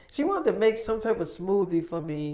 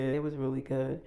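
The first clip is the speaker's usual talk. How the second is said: ordinary talk, nothing marked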